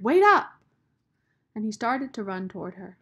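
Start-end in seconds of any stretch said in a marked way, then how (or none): 0.0-0.6 high, loud call across distance; shout. A narrator's reading. Rob calls after Sistine as she walks away, loud. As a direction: call after her desperately. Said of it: "he shouted".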